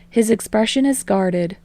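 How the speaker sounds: plain reading voice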